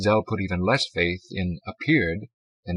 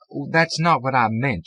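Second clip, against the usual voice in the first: louder, higher clerk voice; woman at the desk; 5 semitones higher, 6 dB louder than usual. A narrator's reading. Woman correcting the visitor, in character voice. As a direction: correct him as the desk attendant.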